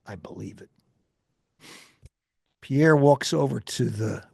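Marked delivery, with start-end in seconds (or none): 0.1-0.7 much softer, low, almost under the breath; soft reply. Retold or imitated. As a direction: murmur it in awe. Reporter answers quietly.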